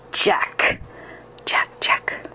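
soft whisper close to the ear; soft ghostly hiss. Acted. Ghost whispers into the hunter's ear, soft hiss. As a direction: hiss it softly right in his ear. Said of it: "the voice hissed softly right into my ear".

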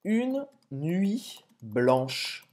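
"une nuit blanche" drawn out very slowly; slow French demo. Shown as a demonstration. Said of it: "a expressão seria". (pronúncia bem lenta de "une nuit blanche")

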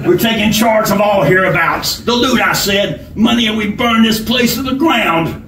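bossy outlaw giving orders; bullying robber. A narrator's reading. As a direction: announce the hold-up like you own the room. Robber threatening to burn the place.

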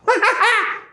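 high witch's cackle; spiteful laugh. A narrator's reading. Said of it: "she gave a spiteful laugh".

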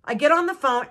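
usual speaking voice

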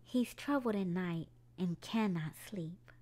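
ordinary delivery, nothing marked

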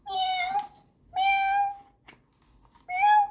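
high squeaky meow; high cat meowing. Retold or imitated. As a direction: mew like a little kitten. Imitating cats.

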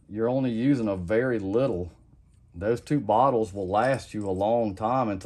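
ordinary talk, nothing marked

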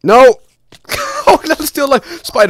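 high, loud panicked shriek; panicked scream. Real, not acted. Attacked by a spider in game.

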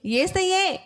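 high, lifted shout of the name; high call. Shown as a demonstration. Preacher leads the children's answer, high ringing call. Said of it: "అనండి అండి అందరూ".

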